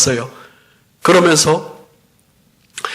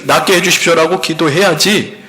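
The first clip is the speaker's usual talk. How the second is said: usual speaking voice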